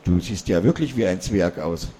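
ordinary delivery

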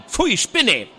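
a loud, high "yuck" at the foul taste; disgusted outburst. A narrator's reading. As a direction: spit it out in revulsion. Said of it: "stieß angewidert die Worte".

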